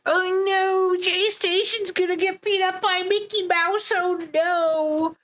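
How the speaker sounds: put-on high, far squeaky kid voice; kids' voices. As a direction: panicky little kid. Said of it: "All the kids would be like".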